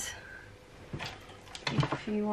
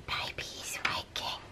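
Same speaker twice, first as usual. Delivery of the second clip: slow unvoiced whisper; 10 dB quieter, slower than usual. Real, not acted. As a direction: whisper it so nobody wakes. Warning the baby may wake.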